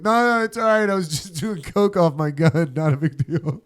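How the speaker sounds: high, loud hurried-excuse voice; mock reassurance. Coked-up shooter calming the bar after gunshot, mock reassurance. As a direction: call out a hammed-up brush-off.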